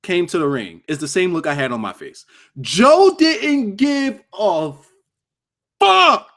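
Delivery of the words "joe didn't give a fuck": high, drawn-out shout, word by word; emphatic outburst. Real, not acted. Streamer telling chat about a wrestler's attitude, emphatic high shout. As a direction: stretch it out high, then punch the last word loud.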